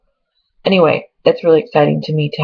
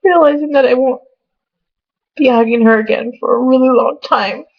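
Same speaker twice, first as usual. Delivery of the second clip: real tears she is trying to talk through; fighting back sobs over leaving her family; crying; 5 semitones higher than usual. Real, not acted. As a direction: voice high and tearful, breaking as she talks. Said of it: "I feel stupid for crying right now".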